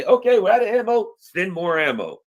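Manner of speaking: mock radio call. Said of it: "you gotta be able to say".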